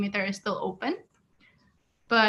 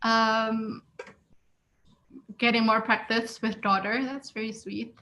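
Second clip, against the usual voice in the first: usual speaking voice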